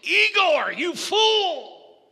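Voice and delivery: high, loud scolding; furious yell. Acted. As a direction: bark it at the servant. Doctor yells at Igor.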